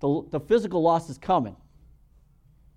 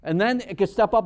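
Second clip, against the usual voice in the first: ordinary talk, nothing marked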